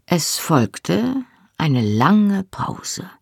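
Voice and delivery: plain reading voice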